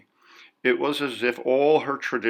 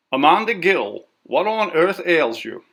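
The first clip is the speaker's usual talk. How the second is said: sister's sharp question; 7 semitones higher, 6 dB louder than usual. A narrator's reading. Sister confronts Amanda.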